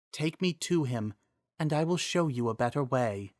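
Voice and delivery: a wise spirit speaking kindly; the Triforce of Wisdom thanking Miranda, gentle and soft; soft female voice. Acted.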